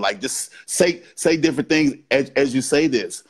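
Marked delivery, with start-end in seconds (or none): none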